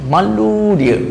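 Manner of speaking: ordinary talk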